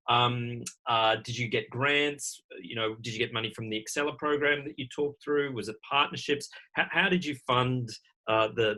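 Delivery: ordinary talk, nothing marked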